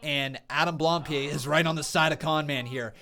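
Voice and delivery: ordinary talk, nothing marked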